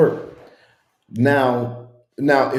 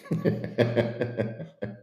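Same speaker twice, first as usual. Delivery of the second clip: breathless laugh; 6 dB quieter than usual. Real, not acted. Laughing hard at the comments.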